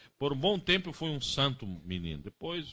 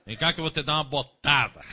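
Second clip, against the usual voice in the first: threatening parent; 2 semitones higher, 8 dB louder than usual. Retold or imitated. Mocked boot-wielding parent.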